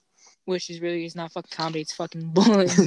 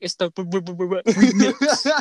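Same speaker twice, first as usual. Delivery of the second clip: teasing stutter remix; 7 dB louder than usual. Retold or imitated. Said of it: "he'd be like".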